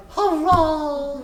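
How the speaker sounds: put-on thin, high little cheer from a sick child; a frail boy's faint hurrah; feeble cheer. A narrator's reading. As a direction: cry it out weakly but gladly. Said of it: "feebly cried".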